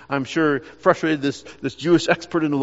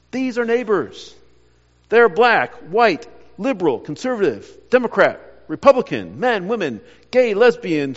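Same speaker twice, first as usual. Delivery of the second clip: louder preacher's list, pressing each word; building sermon; 4 semitones higher, 5 dB louder than usual. Acted. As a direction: drive the point home from the pulpit. Preaching climax, rising force.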